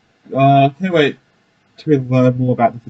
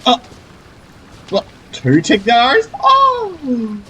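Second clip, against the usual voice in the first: high-pitched disbelieving double take; genuinely floored, voice jumping up; amazed; 11 semitones higher, much slower than usual. Real, not acted. Sees two Tighnaris on screen.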